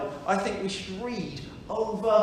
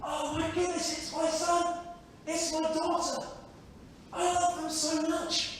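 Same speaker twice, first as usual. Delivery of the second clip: put-on higher and softer, a father overjoyed at his child's return; loving father; 5 semitones higher, 8 dB quieter than usual. Father seeing his lost son come home, higher and softly tender. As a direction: gently overcome with love. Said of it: "Looks at the child and says".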